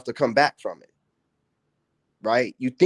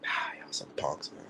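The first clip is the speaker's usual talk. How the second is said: whispered, breathy put-down; a soft sneering whisper to the haters; scornful whisper; 10 semitones lower, 15 dB quieter than usual. Real, not acted. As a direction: breathe it out with contempt. Scornful aside under breath.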